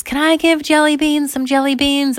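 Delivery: put-on high, loud child's call; calling downstairs. Girl asking parents downstairs for permission, calling out. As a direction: holler it down to the parents.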